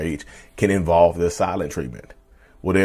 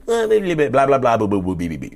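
mocking blah blah; 3 semitones higher than usual. Mocking the narcissist's rambling.

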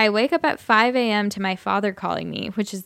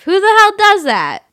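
angry shout; 11 semitones higher, 9 dB louder than usual. Acted. Drunk man ranting in skit.

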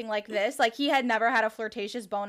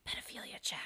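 whispered uneasy aside; 15 dB quieter than usual. Real, not acted. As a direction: drop to a whisper, half-joking. Uneasy aside about child.

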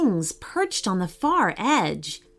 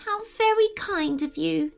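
high, small fairy voice; grateful little fairy; 7 semitones higher than usual. A narrator's reading. Fairy thanking Naya for helping, small high voice. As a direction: thank them in a tiny, higher voice.

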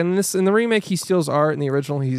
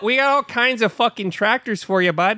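higher-pitched local tractor pitchman; mock Minnesota ad; 6 semitones higher than usual. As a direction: sell it like a hammed-up regional commercial. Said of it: "I would get all these ads".

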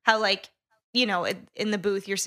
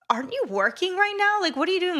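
mock incredulous; 10 semitones higher, 3 dB louder than usual. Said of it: "I'm like".

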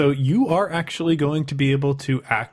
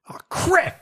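high, distant startled cry; faint voice from inside. Acted. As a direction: call out faintly from behind a door. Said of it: "you hear a faint voice from inside".

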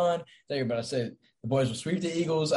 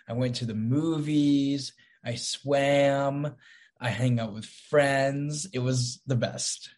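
slow, with stretched line endings; odd drawn-out delivery; much slower than usual. Pretend. Co-host recounting the weekend, oddly drawn out. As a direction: drag out the last word of each phrase. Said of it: "Why are you talking like that?".

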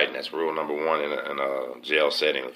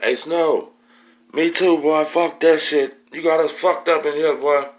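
high, loud angry challenge; imitated yelling; 11 semitones higher, 6 dB louder, slower than usual. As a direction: imitate another inmate hollering threats. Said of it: "they're yelling back and forth in the vent".

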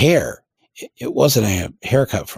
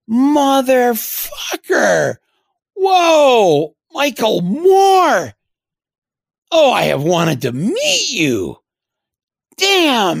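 much higher, slow, loud excited greeting; loud Biden impression; 5 dB louder, much slower than usual. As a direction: belt it out like an overjoyed senator spotting a celebrity. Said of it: "in a loud voice, he goes".

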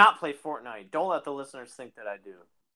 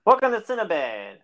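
cheery shop greeting; 7 semitones higher, 5 dB louder than usual. Pretend.

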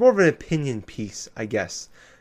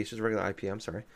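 ordinary talk, nothing marked

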